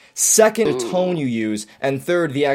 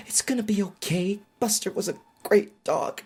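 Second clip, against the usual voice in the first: higher, softer comforting voice; consoling; 6 semitones higher, 4 dB quieter than usual. Retold or imitated. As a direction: soothe a crying friend. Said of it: "people stroke your head and they're".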